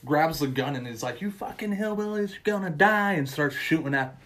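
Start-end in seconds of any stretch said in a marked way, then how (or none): 1.2-3.1 high-pitched mock yell; mock enraged threat. Chad running from the woods with a gun, mock rage.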